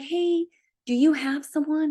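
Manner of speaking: high-pitched, lilting ask; retold hopeful question. Runner asking her run-club friend for a recommendation, high hopeful question. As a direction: re-enact approaching a friend, pitched well up.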